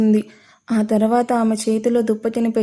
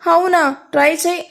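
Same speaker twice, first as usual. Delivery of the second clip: high, mocking taunt with a snicker; sarcastic dare; 6 semitones higher than usual. A narrator's reading. The captor taunts the girl to try jumping, sarcastic. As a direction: sneer the dare back at her. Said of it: "Tarak వ్యంగ్యంగా నవ్వి".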